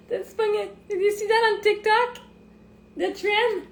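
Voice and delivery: laughing hard, squeaky high; cracking up, barely getting the words out. Real, not acted. Laughing hard while telling viewers about a TikTok trend. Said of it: "they nail it".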